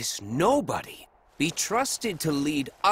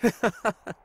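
mocking laugh; 6 semitones higher than usual. Revali mocking rival.

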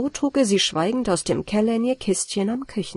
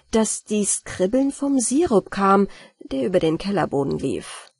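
ordinary delivery

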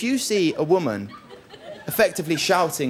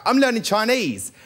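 a light, higher mum voice; imitating mum; 7 semitones higher than usual. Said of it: "She'll go".

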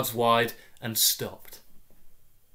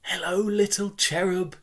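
higher, sing-song sweet mother; cooing mother; 7 semitones higher than usual. Acted. Mother greeting her soaked toddler, sing-song cooing. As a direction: coo it brightly over the crying.

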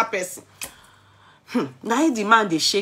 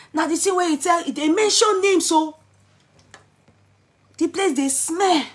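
high, pitched-up retelling of the husband's protest; raised complaint; 9 semitones higher than usual. Retold or imitated. Husband protests to the gathering, voice pitched up high. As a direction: voice him rising in exasperation.

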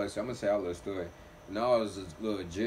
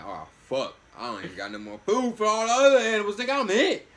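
panicked quote; 9 semitones higher, 7 dB louder than usual. Retold or imitated. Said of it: "I'm like".

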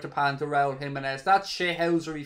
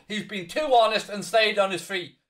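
normal talking voice

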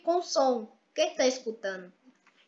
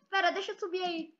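ordinary talk

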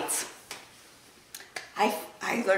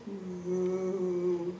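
cow moo; 4 semitones lower, 7 dB quieter than usual. Acted. Cow in story.

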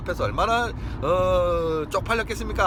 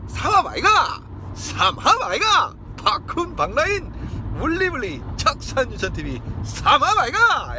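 shout; 9 semitones higher, 4 dB louder than usual. Real, not acted. Cheering the group name.

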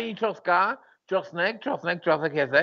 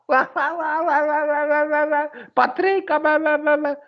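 mock wailing; 8 semitones higher than usual. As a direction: wail like a crybaby to mock him. Mocking friend for crying publicly.

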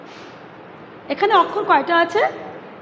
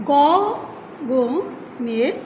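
slow, low syllable counting; 3 semitones lower, 7 dB quieter, much slower than usual. Shown as a demonstration. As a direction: count out গ, গ, নে, র, জে, মেঘ one beat at a time. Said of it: "দেখো". (মাত্রা গুনে গুনে ধীরে উচ্চারণ)

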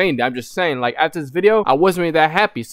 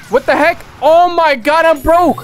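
outraged disbelief; 6 semitones higher, much slower than usual. Real, not acted. Gamer glitched out of the match, outraged wail. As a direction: cry out at being cheated. Player discovers a game-breaking glitch.

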